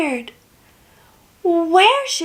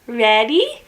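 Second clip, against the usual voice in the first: normal talking voice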